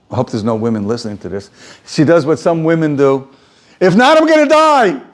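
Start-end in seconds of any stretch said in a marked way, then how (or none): 3.8-5.0 high, loud, hammed-up desperate cry; mock dramatic wail. Rachel wailing to Yaakov that she will die, hammed-up high cry. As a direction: play Rachel crying she'll die without children.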